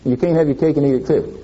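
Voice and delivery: usual speaking voice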